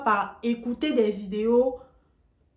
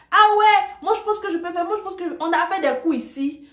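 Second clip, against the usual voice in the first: high, smug mock voice; hammed-up boastful learner; mocking know-it-all; 8 semitones higher, 5 dB louder than usual. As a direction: parody the cocky student, much higher.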